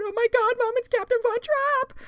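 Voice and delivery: loud, high-pitched starstruck squeal; fangirling outburst. Retold or imitated. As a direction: re-enact an over-excited fan shrieking to mom at a celebrity sighting. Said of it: "I was completely fangirling".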